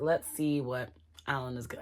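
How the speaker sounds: normal talking voice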